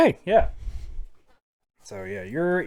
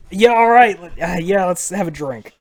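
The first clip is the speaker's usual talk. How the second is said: confused; 4 semitones higher, 6 dB louder than usual. Retold or imitated. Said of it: "like, so confused".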